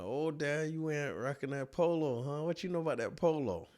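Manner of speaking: high playful ribbing of dad; teasing. Retold or imitated. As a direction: rib your father about his shirt. Teasing dad about his polo.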